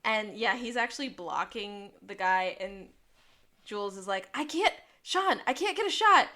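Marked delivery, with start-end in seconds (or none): 4.3-6.3 high, raised, urgent voice; acted urgent shout. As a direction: call out urgently, imitating the character. Acts out Jules unable to shoot.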